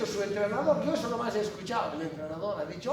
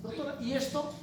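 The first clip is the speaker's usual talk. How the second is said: confident student explaining; 5 semitones higher, 4 dB quieter than usual. Retold or imitated. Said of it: "les van a hablar de ciertos conocimientos".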